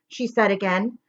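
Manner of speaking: usual speaking voice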